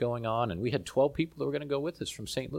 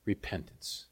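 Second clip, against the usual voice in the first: hushed; 5 semitones lower, 9 dB quieter, slower than usual. Acted. Repeats key word, hushed.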